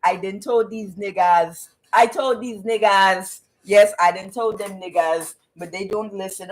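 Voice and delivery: put-on African accent, slowed at first; mock African accent, muttered to himself. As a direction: imitate a man with an African accent. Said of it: "he's saying it in, in an African accent".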